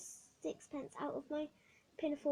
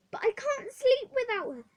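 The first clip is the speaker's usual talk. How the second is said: much higher, loud wail; girl pleading with the matron; 12 semitones higher, 11 dB louder than usual. Acted. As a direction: plead for your doll. Hetty pleads for doll.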